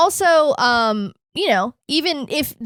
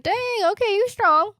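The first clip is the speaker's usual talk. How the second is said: higher impressed villain voice; impressed villain voice, pitched up; Doflamingo; 9 semitones higher than usual. Retold or imitated. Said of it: "Dolphy's like".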